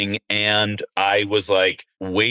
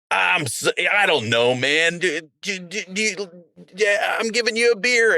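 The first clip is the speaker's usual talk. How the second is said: higher, puzzled, halting voice; confused guy offering beer; 10 semitones higher than usual. Retold or imitated. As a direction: stammer out a peace offering, baffled. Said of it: "And he was like".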